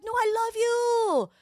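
exaggerated high-pitched loving voice; Chinwe. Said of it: "She doesn't talk like that".

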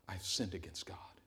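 breathless whisper; David weeping on his face; whispered confession. Acted. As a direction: confess in a broken hush.

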